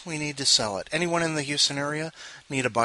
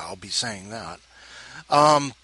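usual speaking voice

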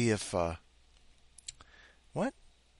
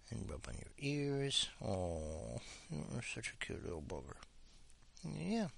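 much softer tender murmur to the dog; soft pet talk; 14 dB quieter than usual. Real, not acted. Owner rubbing the dog's ears, soft murmur.

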